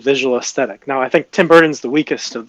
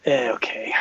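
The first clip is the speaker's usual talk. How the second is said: breathy, unvoiced whisper of agreement; 7 dB quieter than usual. Real, not acted. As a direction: whisper a reluctant okay. Reluctant reply to the GM.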